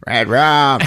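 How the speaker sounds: Tony from The Shining. Retold or imitated. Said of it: "She sounds like Tony from The Shining".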